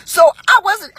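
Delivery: normal talking voice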